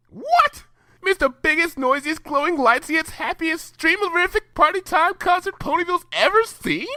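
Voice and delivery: extremely high, gushing pony voice; Pinkie Pie voice. Acted. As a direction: squeal way up, piling up the words.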